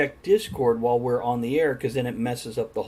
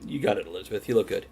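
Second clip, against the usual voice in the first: ordinary talk, nothing marked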